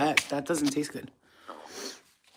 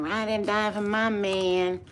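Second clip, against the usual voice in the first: mocking slow brag; 3 semitones higher, slower than usual. Mocking a thirty-year-old bragging.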